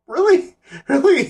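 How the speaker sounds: high, loud disbelieving really; sarcastic disbelief; mock shocked repeat; incredulous.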